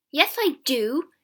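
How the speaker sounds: loud, slow insistence; angry. A narrator's reading. James snaps back at his sister's denial, angry. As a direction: insist angrily, drawing the words out. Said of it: "James said angrily".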